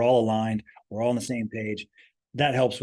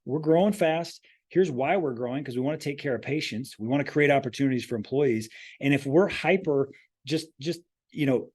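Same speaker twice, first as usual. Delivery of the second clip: ordinary talk, nothing marked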